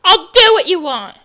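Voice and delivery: loud, bitter shout; mother gives in, shouting through tears; loud outburst. Acted. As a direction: fling the words out in anger. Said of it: "Your mom sobbed uncontrollably".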